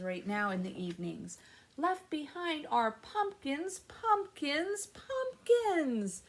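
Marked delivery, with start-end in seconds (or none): 1.8-6.2 pumpkins climbing much higher each time; playful sing-song. Acted. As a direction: build the repetition up playfully. Playful reading, rising repetition.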